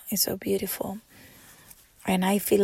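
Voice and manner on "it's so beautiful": soft unvoiced repeat; whispered echo. Real, not acted. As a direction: whisper it to yourself.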